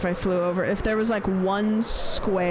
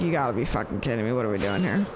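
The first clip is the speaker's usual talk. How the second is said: exasperated mock disbelief; 9 semitones lower, much faster than usual. Exasperated quote of spelling.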